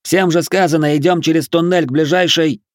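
high, insistent yell; shouted order. A narrator's reading. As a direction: insist loudly on the plan. Militiaman shouting over crowd.

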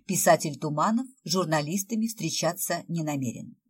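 ordinary delivery

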